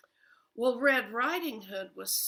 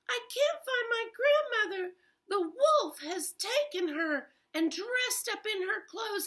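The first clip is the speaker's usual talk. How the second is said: high, louder, near-crying plea; upset girl; 9 semitones higher, 4 dB louder than usual. Acted. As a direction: rush it out like a scared child. Frightened Red pleads.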